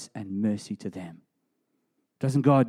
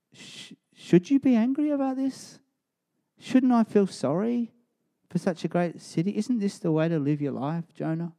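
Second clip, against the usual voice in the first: gentle God; 7 semitones higher than usual. Acted. Voicing God gently to Jonah.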